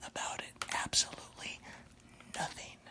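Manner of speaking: teasing unvoiced whisper; hushed mocking whisper. Real, not acted. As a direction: whisper it like a taunt. Taunting viewers in whisper.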